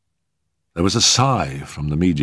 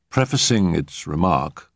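ordinary delivery, nothing marked

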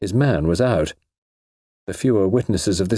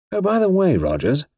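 lightly casual, pitched up then dropping; 11 semitones higher than usual. A narrator's reading. Suspect stopping the porter by the stairs, casually offhand. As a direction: toss it in as an afterthought. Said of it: "he said casually".

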